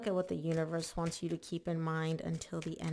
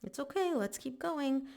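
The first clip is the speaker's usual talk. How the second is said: much higher, sing-song encouragement; coaxing partner; 7 semitones higher than usual. Retold or imitated. Partner reassuring panicking Libra, lightly voiced high coaxing. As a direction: coax them along like cheering a nervous friend. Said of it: "And your person's like".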